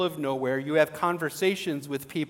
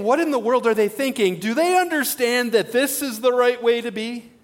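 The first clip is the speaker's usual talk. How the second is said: mild mock judging; 6 semitones higher, 6 dB louder than usual.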